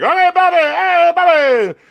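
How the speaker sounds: very high, loud mock-flirty squeal; imitated cheering ladies. Ladies in the lounge greeting Bobby, mock high-pitched cheer. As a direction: mimic a group of women hailing their man.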